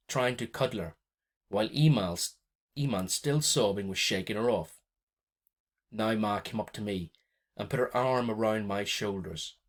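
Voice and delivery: usual speaking voice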